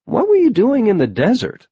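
high-pitched questioning quote; prying question. A narrator's reading. People asking probing questions.

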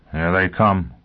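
ordinary delivery, nothing marked

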